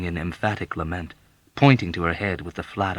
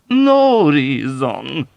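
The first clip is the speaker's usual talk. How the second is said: very slow, high, loud wail; 8 semitones higher, 10 dB louder, much slower than usual. Acted. Old woman wailing to Yossarian, slow high lament. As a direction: stretch it into a mournful wail. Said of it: "wailed the old woman".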